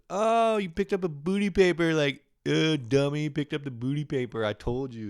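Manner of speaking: high, loud taunting imitation; exaggerated mimicry of being teased; mocking teaser. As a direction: taunt like a gloating partner. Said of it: "And she goes".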